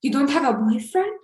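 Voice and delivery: imitated Albanian accent, rising question; surprised question in a cute Albanian accent. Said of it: "I'm just trying to do his cute accent".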